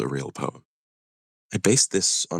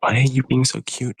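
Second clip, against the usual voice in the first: playful teasing; 8 semitones higher than usual. Real, not acted. Teasing friend, high and playful.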